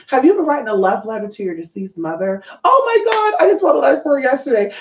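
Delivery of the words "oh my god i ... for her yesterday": high, fast gush of surprise; excited client. Acted. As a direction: blurt it out high and amazed. Acts out client's excited reply.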